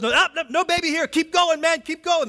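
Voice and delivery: high, loud and fast, waving the others on; frantic searching shepherd. Retold or imitated. Shepherd telling the others no baby here, high hurried call. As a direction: brush past an empty trough. Shepherd checking troughs, rushing on.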